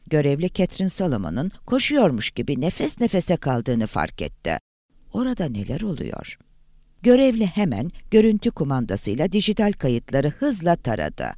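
ordinary delivery

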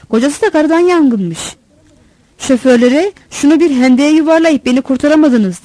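eager interjection. A narrator's reading. Assistant jumps into the gossip about the husband, eager. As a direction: cut in eagerly with your bit of gossip. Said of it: "Muavin atıldı".

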